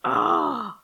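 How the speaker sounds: breathy imitated sob, not pretty; a mock ugly crying gasp; unvoiced sob sound mimicking her crying. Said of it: "It wasn't pretty cry".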